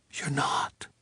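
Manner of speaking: hushed, stunned whisper. Acted. Sadie whispering to George in disbelief, a whisper. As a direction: breathe it out under your voice. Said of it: "she whispered".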